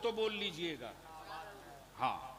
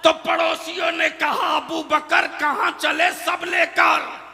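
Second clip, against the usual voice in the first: loud, high, ringing sermon delivery; full-force preaching; 9 semitones higher, 17 dB louder than usual. Real, not acted. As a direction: preach it out to a big crowd. Tells story in preaching force. (पूरे जोश में तक़रीर)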